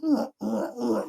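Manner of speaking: grunting uh uh uh like a creaking floor; a sketchy floor-squeak imitation; imitated floor creaks. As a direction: mimic the floor shaking in short high grunts. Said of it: "you hear the floor just going".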